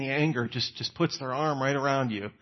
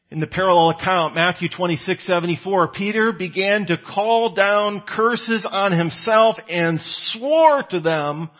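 normal talking voice

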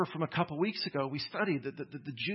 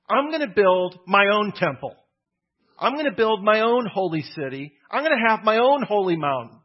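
loud defiant vow, higher; defiant declaration; 5 semitones higher, 8 dB louder than usual. Acted. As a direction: shout out the defiance as Manasseh. Manasseh defiantly declares own temple.

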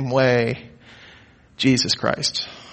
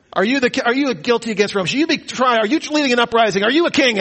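Pilate interrogating; 8 semitones higher than usual. Retold or imitated. Voicing Pilate's questioning of Jesus.

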